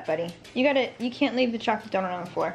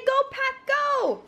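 cheering. Shown as a demonstration.

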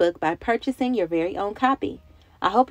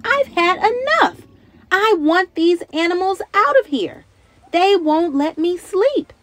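high, loud, exasperated complaint; fed-up outburst; 9 semitones higher, 5 dB louder, slower than usual. Acted. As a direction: let Natalie finally snap, loudly. Natalie fed up with animals.

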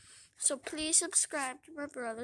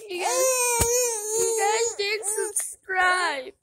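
angry mock wail; 6 semitones higher, 11 dB louder than usual. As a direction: bawl it at them in pretend outrage. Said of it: "I'm getting angry at you guys".

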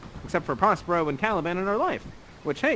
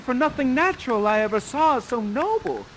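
Miranda in wonder; 6 semitones higher than usual. Acted. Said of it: "and she says".

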